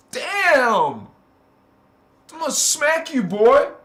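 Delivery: loud, high-pitched comic threat; mock scolding. Threatening the old self jokingly, mock angry scolding. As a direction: scold your old self with hammed-up outrage. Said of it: "I'm looking at my old self is like".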